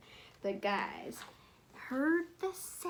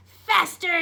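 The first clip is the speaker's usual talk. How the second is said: loud, higher stern yell; yelled command; 7 semitones higher, 11 dB louder than usual. Acted. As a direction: yell at the runners. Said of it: "yelled Coach Swamp".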